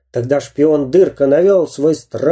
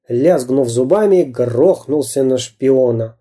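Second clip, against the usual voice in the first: plain reading voice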